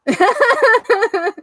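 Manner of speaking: high, tearful laugh on a single word; a choked, teary laugh as emotion spills over; laughing through tears. Real, not acted. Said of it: "I get so emotional".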